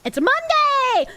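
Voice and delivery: sudden shocked "Monday!"; surprised realisation. Real, not acted.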